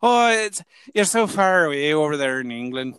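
put-on Irish lilt; chatty stage Irish accent. Shown as a demonstration. Showing off an Irish dialect to the host, lilting accent. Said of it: "how do I do my Irish?".

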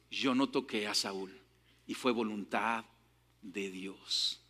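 humble quiet confession, fading; hushed humble. Acted. David's humble testimony.

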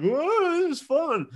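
high-pitched, giddy whoop; retold high wow of delight; drunken glee. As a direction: whoop it up like a reckless drunk partygoer. Drunk delight at fireball singeing eyebrows.

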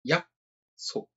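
やっ そ with a clipped stop, then そ; Korean pronunciation. Shown as a demonstration. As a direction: say やっそ in two beats. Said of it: "やっ そ".

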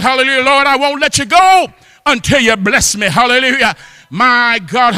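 usual speaking voice

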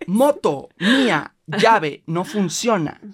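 mock pidgin, word by word; slow broken words to a foreigner. Tourist spelling out broken words to the Thai woman.

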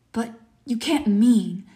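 stunned, slowed-down protest; aghast gasp. A narrator's reading. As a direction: draw it out as if appalled. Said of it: "gasped Rainsford".

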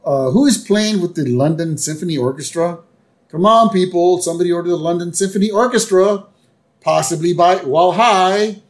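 lightly voiced man paging a crowd; stagehand calling out. A guy asking who ordered the orchestra, calling out.

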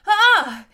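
high, loud shriek of fright; frightened shriek. Acted. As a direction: shriek as the wolf lunges. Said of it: "he shrieked".

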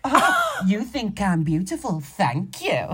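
high swooping delighted oh; playfully flattered reply; delighted mock gushing. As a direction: gush with fake flattered joy. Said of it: "And I'd go".

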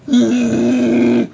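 high, loud laughing yell; yell at the fish. Pretend. Yelling into a tube at the fish. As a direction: let out a big silly yell. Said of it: "and then I yell".

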